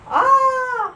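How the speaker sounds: high, loud yelp of fright; startled cry. Acted. Frog startled by the dog, high loud yelp. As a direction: jump with fright. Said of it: "says the frog".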